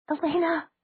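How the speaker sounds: high gasping cry of her name; gasped cry. Acted. Bonnie gasping at Elena on screen. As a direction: gasp the name out in shock. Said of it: "Bonnie gasped".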